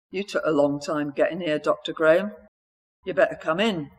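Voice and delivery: put-on abrupt old man, South London accent; gruff South London accent, curt and impatient. A narrator's reading. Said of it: "abruptly, in a South London accent".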